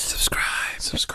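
whispered ghostly chant; haunting voice. Pretend. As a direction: whisper it like a phantom. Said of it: "Weird, weird ghostly voices".

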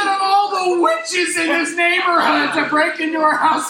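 laughing amazed friend; laughing disbelief. Retold or imitated. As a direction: crack up at the news. Friend reacting on phone.

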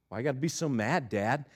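low, soft, quick question; imitated small quiet kid; meek child. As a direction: ask an angry dad meekly. Voicing child questioning angry dad.

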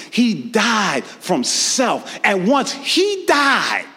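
high, slow preaching shout; slow building preacher shout. Real, not acted. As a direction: build slowly to a cry.

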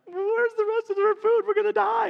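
put-on high, whiny, panicked teen voice; mock the scared students, squeaky; panicky kids. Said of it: "these junior hires are like".

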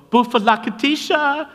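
put-on high, loud squeal of a little girl calling up; imitated girl's yell. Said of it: "She just yelled at us".

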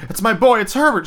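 high, loud frantic cry. A narrator's reading. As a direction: cry out desperately for the son. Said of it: "she cried, struggling mechanically".

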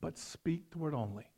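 soft, reverent reading of the centurion's request; humble plea. Acted. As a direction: plead it quietly, humbly. Scripture quote, centurion's plea.

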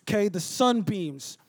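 ordinary talk

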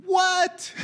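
high, stunned exclamation; incredulous high what; amazed what. Real, not acted. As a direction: gasp it out in disbelief. Preacher amazed by the verse.

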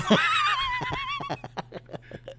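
long, high-pitched cackle; helpless high laughter; laughing hard. Real, not acted. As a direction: crack up at a dirty joke. Cracking up at dryer joke.

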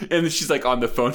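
ordinary talk

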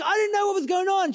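very high-pitched acted protest; defensive protest. Traveler answering partner's scolding after the swindle, defensive protest. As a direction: plead ignorance at high pitch.

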